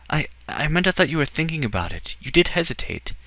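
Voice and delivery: put-on high, stammering boy's voice; young Edward, nervous.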